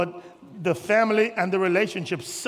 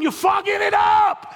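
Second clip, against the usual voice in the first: much higher, slow shouted accusation; high drawn-out shout; 11 semitones higher, 4 dB louder, slower than usual. Acted. Pastor accusing the church, high slow shout. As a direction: call them out, stretched and loud. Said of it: "That's what you're doing".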